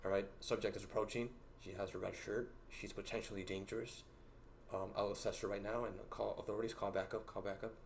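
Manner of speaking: hushed, mock-serious radio report; mock security guard report. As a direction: play a guard reporting a suspect into a shoulder mic. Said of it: "And then I just go like".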